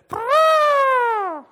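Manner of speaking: high, loud mock elephant trumpet; long, high-pitched elephant noise. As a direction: imitate a toy elephant trumpeting. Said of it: "The elephant says".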